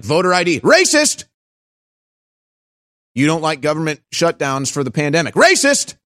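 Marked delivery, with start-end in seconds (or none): 0.6-1.2 one high, loud mocking word; mock shrill accusation. 5.3-5.9 one high, loud mocking word; mock shrill accusation.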